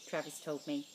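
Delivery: plain reading voice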